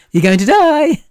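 squealed high and loud, hammed-up; mock cheery surprise. Imagining death arriving as a cheery surprise, squealed high. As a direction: shriek it like a party popper going off. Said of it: "It'll be like".

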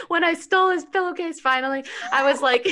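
laughing. Real, not acted. Admitting to her podcast co-host she stole a pillowcase, laughing. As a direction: laugh the words out. Telling the story through laughter.

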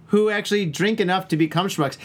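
normal talking voice

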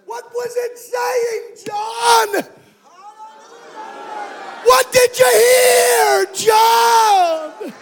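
high, slow pleading cry; high called question; calling out to John. Acted. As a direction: call out to John across time. Preacher calls out to John.

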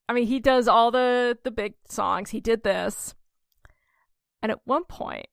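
ordinary talk

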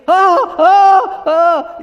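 loud, high, hammed-up terrified wails; panicked cries. Disciples freaking out as Jesus comes, mock panicked cries.

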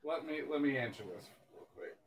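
speaking away from the microphone; far from mic. Real, not acted. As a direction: voice distant, off the mic. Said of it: "You're, you're a little far away".

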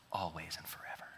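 soft whispered promise. Acted. Spouse whispering a promise at the reception, hushed. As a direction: breathe it out tenderly.

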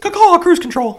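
high squawking parrot call; chirping bird person. Pretend. Parrot voice-command bit.

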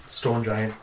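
ordinary talk, nothing marked

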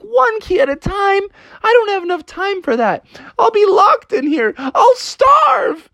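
very high, melodramatic wail; outraged panic. Acted. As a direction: squeal in despair, building to a cry. Frog despairs at delay.

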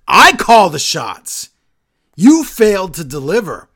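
slow, loud shouted rebuke; imitating Reigns screaming. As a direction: bark it slowly at him. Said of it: "Reigns is just screaming at him".

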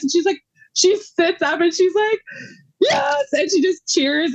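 high, tearful telling through emotion; teary voice. Real, not acted. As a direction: voice breaking while telling. Emotional retelling, voice breaking.

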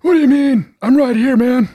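loud, high-pitched mock protest; John. Player impersonating absent castmate, loud high-pitched mock protest. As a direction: pitch it way up, loudly indignant put-on voice. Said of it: "That's what he would say".

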